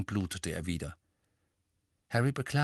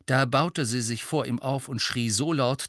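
ordinary delivery

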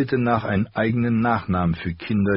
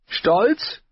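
high, sharp and louder indignant question; snapping back; 4 dB louder than usual. A narrator's reading. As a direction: spit the word back at him. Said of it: "fuhr Catelyn ihn an". (empörtes, scharfes Anfahren)

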